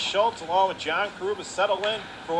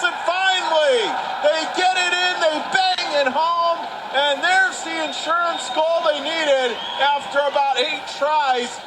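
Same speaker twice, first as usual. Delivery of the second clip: shouting the goal, higher and louder; goal call; 8 semitones higher, 3 dB louder than usual. Real, not acted. Play-by-play announcer calling the insurance goal, shouted with joy. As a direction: roar it home as the puck finally goes in. Goal scored after many tries.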